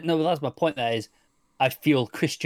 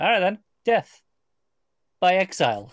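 loud, higher, deadpan verdict; mock Gordon line; 6 semitones higher, 5 dB louder than usual. Retelling Gordon's verdict on the cop, louder and higher. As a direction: boom out death by exile. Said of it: "And he's like".

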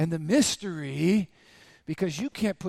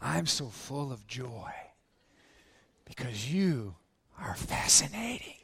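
acted tender joy; 6 semitones lower, 6 dB quieter than usual. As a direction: play a husband softly marvelling at his wife. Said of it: "You say".

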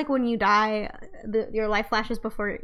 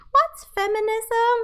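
put-on much higher and louder, slow child's question; curious young girl; 12 semitones higher, 5 dB louder, slower than usual. A nine-year-old girl asking the teacher, high and loud. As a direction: ask it like a wide-eyed nine-year-old. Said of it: "nine-year-old girl being like".

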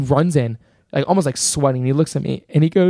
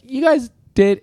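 high, hesitant professor voice; high, unsure teacher voice; imitating professor; 10 semitones higher, slower than usual. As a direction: imitate the professor stalling mid-praise. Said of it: "he looks at my partners and he's like".

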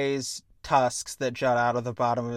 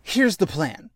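exasperated. Retold or imitated. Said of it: "And it's like".

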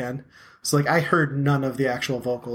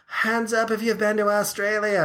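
higher, put-on Australian crowd call; mock Australian accent; 9 semitones higher than usual. Singer asking the crowd about Australia, imitated Australian accent. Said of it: "I'm giving him, like, a weird accent".

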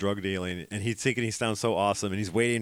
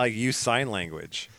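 ordinary talk, nothing marked

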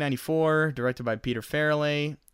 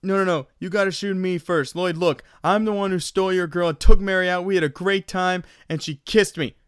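higher, louder goofy comic voice; goofy movie character pleading; 5 semitones higher, 5 dB louder than usual. Retold or imitated. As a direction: insist on being shot, silly and earnest. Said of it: "He's like".

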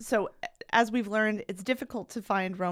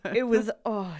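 usual speaking voice

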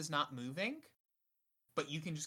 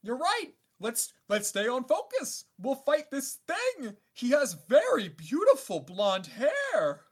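high, loud bubbly underwater voice; shouting underwater; 9 semitones higher, 6 dB louder than usual. Pretend. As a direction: call out as though submerged. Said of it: "I kinda wanted to do the underwater voice".

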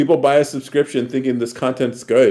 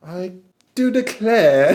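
slow, high, mock-genteel accent; a fussy aristocrat, slowly; posh accent; 7 semitones higher, slower than usual. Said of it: "talk the British accent".

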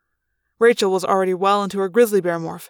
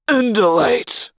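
bellowed; 3 semitones higher, 6 dB louder, slower than usual. Acted. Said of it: "a Hork-Bajir voice bellowed".

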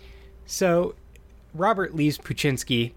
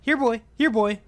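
calling a dog; 7 semitones higher than usual. Retold or imitated. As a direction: call out like summoning a pet dog. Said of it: "relative voices ... saying".

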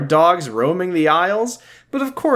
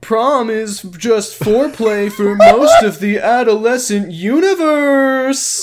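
high, slow goofy dude voice with a laugh; Kyle doing Josh; 10 semitones higher, 4 dB louder, slower than usual. Shown as a demonstration. [name] redoing the impression on request, high goofy voice. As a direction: stretch out universe in a silly high voice. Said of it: "now I'll do the voice".

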